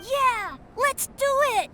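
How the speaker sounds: put-on a little boy itching to race; excited boy voice.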